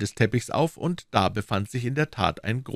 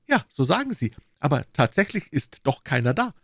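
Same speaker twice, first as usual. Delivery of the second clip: higher, lightly voiced smug police inspector; a self-satisfied detective pleased with himself; Lestrade, smug inspector; 7 semitones higher than usual. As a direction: bit higher, mildly triumphant official.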